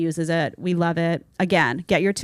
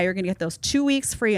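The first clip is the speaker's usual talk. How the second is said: normal talking voice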